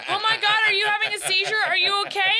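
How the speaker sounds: high, loud panicked mother; imitating a frantic mom calling her daughter; mom freaking out. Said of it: "my mom freaks out".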